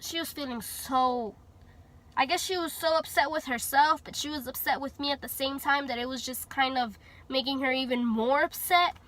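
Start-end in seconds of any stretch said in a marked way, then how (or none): none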